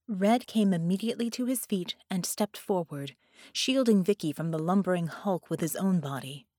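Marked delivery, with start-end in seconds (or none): none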